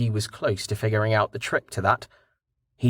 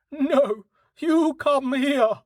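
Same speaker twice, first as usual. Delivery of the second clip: very high, slow horse voice; high-pitched cartoon horse retort; defiant horse; 14 semitones higher, much slower than usual. A narrator's reading. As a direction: answer back as a stubborn horse, high and drawn out. Horse Dust answers back in thought.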